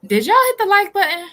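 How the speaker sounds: high, loud, shouted question to the chat; loud call-out. Real, not acted. Calling out to the viewers.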